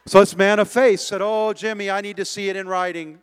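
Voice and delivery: mock doubt. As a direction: ham up your own doubt.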